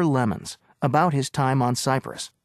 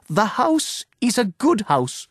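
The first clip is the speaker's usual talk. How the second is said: cried out; 7 semitones higher than usual. A narrator's reading. Owner swears by the key that her house is good, crying out. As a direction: cry it out like an oath. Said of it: "she cried".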